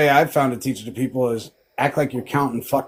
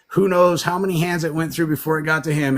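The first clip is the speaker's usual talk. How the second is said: usual speaking voice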